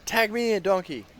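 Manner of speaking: hyped-up wrestler's call. Acted. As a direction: shout to be tagged into the ring. Player calls to donkey in character.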